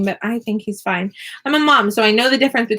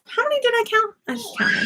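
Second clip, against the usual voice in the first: puzzled callout; 12 semitones higher, 3 dB quieter than usual. Real, not acted. Calls out, losing count.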